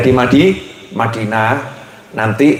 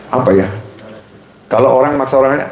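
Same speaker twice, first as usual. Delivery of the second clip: ordinary talk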